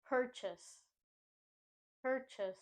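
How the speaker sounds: Purchase as "PUR-chus"; strong PUR, weak chus in Purchase; stress on first syllable. Shown as a demonstration. Teacher modelling purchase for learners, PUR-chus. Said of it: "Chase in this word is pronounced as chus".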